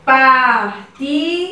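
"Partida" said very slowly, each syllable separate. Shown as a demonstration. Teacher separating "Partida" for the third graders, slowly. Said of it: "Como no exemplo".

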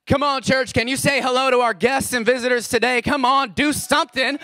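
high, loud call to the congregation; rallying shout. Real, not acted. Pastor urging church to welcome visitors, rallying shout. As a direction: whip the crowd up to cheer.

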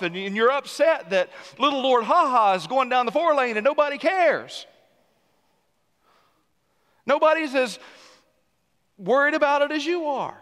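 high, loud, driving pulpit voice; fiery preaching. Acted. Pastor pressing the congregation about self-worship, preaching at full force. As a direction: press the point home to the congregation.

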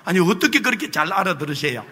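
normal talking voice

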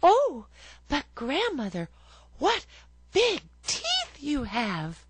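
put-on high, bright child voice; little girl. A narrator's reading. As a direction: answer like a trusting little girl.

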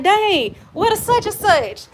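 high-pitched mock "dang" of disbelief; acted dismayed surprise. Telling viewers about raided leftovers, in high mock dismay. Acting out finding the food gone.

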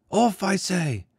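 put-on high child's voice, insistent; a small boy ordering a man off; boy's cry. A narrator's reading. The boy demanding Jurin step off.

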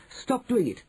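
high-pitched protest; urgent, high voice without shouting; indignant cry. A narrator's reading. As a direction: cry out to make him stop. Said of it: "I cried".

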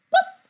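squeaky high "pop"; high comic pop. A narrator's reading. Sound word read with effect.

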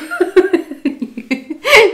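high burst of laughter; a loud real laugh. Real, not acted. Laughing at own story.

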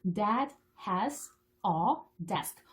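very slow, word by word; reading demonstration. Shown as a demonstration. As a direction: model each word separately for a beginning reader. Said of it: "이제 이거 읽어보면 읽을 수가 있어요". (한 단어씩 아주 천천히 끊어 읽기)

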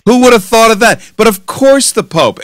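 mock agreement. Real, not acted. Host reacting to guest on monitoring the Pope, sarcastically incredulous. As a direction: pitch it up in mock obviousness.

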